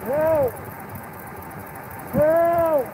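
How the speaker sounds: calling for help. Real, not acted. Repeated calls for help while held.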